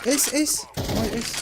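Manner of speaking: loud, high excited callout; esports caster. Real, not acted. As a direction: yell the ace like a caster. Calling out an ace in game.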